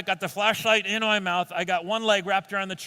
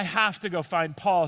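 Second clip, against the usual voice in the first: ordinary talk, nothing marked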